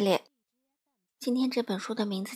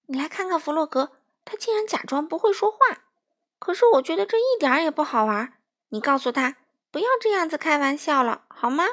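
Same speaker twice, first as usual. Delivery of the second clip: high, louder voice of an acted protest; indignant complaint; 6 semitones higher, 7 dB louder, faster than usual. A narrator's reading. Duck complains to Pig about Frog's supposed joke, indignant. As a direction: complain like an upset storybook duck.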